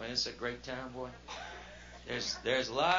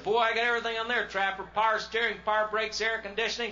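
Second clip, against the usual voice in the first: proud boasting; 7 semitones higher, 4 dB louder than usual. Retold or imitated. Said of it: "I said".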